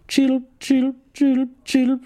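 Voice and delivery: high twittering bird chirps; bird chirping. Acted. Psychiatrist pretends to be a bird, high chirps. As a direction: chirp like a little songbird. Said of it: "zwitschert der Psychiater".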